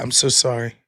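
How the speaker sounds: usual speaking voice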